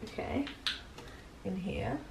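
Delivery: hushed and unsure; softly puzzling it out; quiet. Real, not acted. Quiet while lighting fire.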